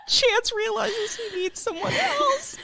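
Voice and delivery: high, voice breaking as if about to cry; teary. Real, not acted. Retelling the movie to a co-host, high and tearful. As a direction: choke up while telling it.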